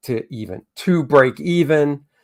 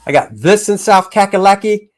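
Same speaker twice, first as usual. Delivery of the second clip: louder, proud announcement; excited; 5 semitones higher, 6 dB louder than usual. Real, not acted. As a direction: shout it out proudly. Brags loudly about the cover.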